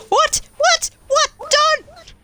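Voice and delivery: panicked high shouting; yelling in fright while fleeing; being chased. Real, not acted. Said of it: "I'm being chased".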